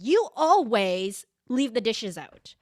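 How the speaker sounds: nagging a partner with a loud, slow accusing tone. Retold or imitated. Wife scolding her partner about the kitchen, slow, loud and accusing. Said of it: "in that tone of voice".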